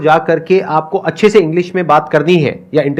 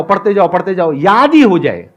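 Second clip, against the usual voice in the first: normal talking voice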